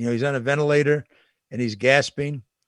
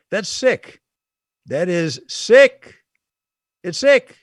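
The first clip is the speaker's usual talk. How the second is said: higher and louder, repeating it with disgust; disgusted outburst; 5 semitones higher, 8 dB louder, much slower than usual. Real, not acted. Host reacting to the vaccine news, disgusted and loud. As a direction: spit out the judgement, slow on the middle one.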